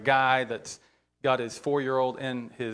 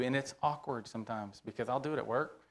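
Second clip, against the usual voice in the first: ordinary delivery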